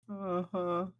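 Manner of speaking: put-on teary girl.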